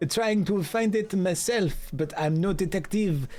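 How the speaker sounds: museum curator voice. Acted. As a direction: welcome the visitor as the curator. Curator asks for help.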